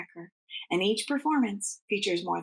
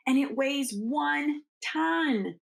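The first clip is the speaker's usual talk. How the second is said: plain reading voice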